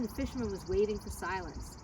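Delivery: ordinary delivery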